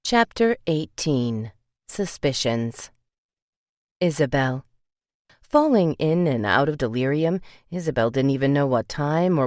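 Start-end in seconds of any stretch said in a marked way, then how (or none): none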